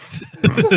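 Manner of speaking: high burst of laughter. Real, not acted. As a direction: giggle high and quick. Friend teasing about games.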